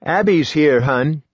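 husband announcing their daughter to his wife, falsely jolly. Acted. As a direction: paper over the dread with fake brightness. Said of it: "in a falsely jolly voice".

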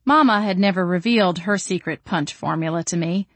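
plain reading voice

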